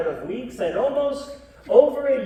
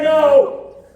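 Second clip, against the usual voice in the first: loud, higher no; firm loud refusal; 7 semitones higher, 10 dB louder than usual. Acted. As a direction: refuse it like a king. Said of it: "and Max said".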